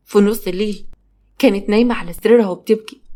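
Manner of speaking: ordinary delivery, nothing marked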